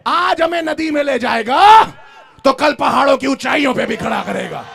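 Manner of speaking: high, loud preaching shout; fiery sermon shout; full-force preaching. Acted. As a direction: preach it out to the crowd.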